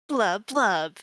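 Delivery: shouting underwater; wave voice. Shown as a demonstration. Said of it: "this is my wave voice".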